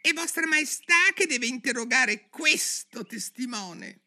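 tiny, very high rabbit voice. A narrator's reading. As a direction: squeak it as a confidential aside. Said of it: "che disse a bassa voce". (vocina acutissima da coniglio)

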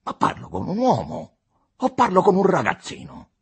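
loud, high exasperated scolding; arrogant deputy, scornful. A narrator's reading. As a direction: berate him like a small boy. Capolino scolds Costa. (deputato sprezzante che rimprovera a voce alta)

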